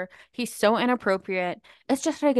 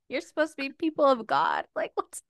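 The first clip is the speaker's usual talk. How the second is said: high, amused imitation of a scolding mom; mock-appalled mother; retold upset mom; 6 semitones higher than usual. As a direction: scold like mom, half laughing. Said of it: "she was so upset with us".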